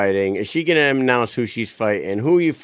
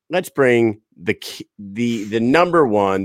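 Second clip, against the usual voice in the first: ordinary delivery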